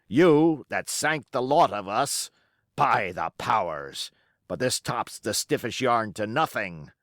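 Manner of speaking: a scornful pirate captain holding his ground; defiant old sea-cook pirate. Acted. Pirate cook rebutting the mutineers' charges, defiant. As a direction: roll the words out like a seasoned buccaneer defending his command. Silver's long defiant speech.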